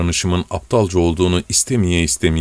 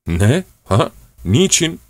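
stuttering, last word much higher; stammering; 6 semitones higher, slower than usual. Acted. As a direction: sputter in disbelief.

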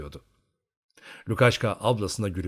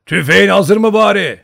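very loud, high bellow; booming, full-voiced question; crowd-sized shout; 10 semitones higher, 13 dB louder than usual. A narrator's reading. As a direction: yell as if to a houseful of people. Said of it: "bir yığın insan varmış gibi bağırdı".

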